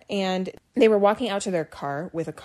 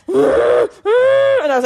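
laughing shout; 13 semitones higher, 9 dB louder, much slower than usual. Retold or imitated. Reliving her meltdown, laughing.

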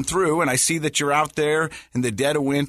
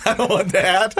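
higher-pitched mock protest through laughter; amused protest; 5 semitones higher, 4 dB louder than usual. Daughter to dad after his bad joke, laughing mock protest. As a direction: imitate a daughter objecting, laughing as you go.

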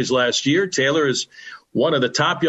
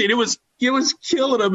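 excited; 11 semitones higher than usual. Real, not acted. Excited retelling.

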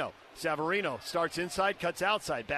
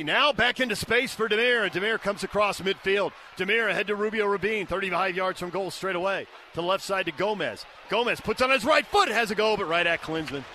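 higher and louder, building call; excited play-by-play; 3 semitones higher, 5 dB louder than usual. Real, not acted. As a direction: ramp up to the shot. Commentator calls an attack and shot.

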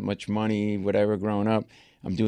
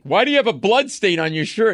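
high, loud mock scolding yell; retold screaming; 10 semitones higher, 5 dB louder than usual. Senior partner yelling at lawyer in meeting, mock screaming. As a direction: imitate a boss yelling an accusation. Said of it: "senior partner started screaming at me".

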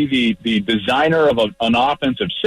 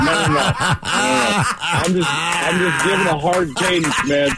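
laughing; 2 semitones higher than usual. Retold or imitated. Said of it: "when I was just shouting".

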